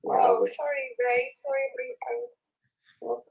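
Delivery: high-pitched. Acted. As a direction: say it again way up high. Reading a comment twice.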